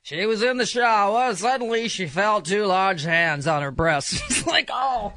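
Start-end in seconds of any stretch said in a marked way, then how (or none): 0.0-4.2 mock dramatic reading. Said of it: "exactly like the phone sex transcript". 4.7-5.1 mock woman. Voicing the woman in the excerpt.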